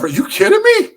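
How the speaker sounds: high-pitched disbelief; incredulous. Retold or imitated. Prospect thinking to himself during the lecture, incredulous.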